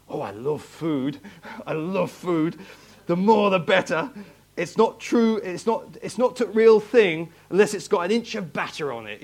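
put-on comic northern English accent; imitated northern English accent. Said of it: "with a nice northern accent".

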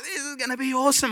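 lightly voiced thrilled wife, high and quick; retold excited wife. Husband imitating his wife waiting on the ride, mildly gushing.

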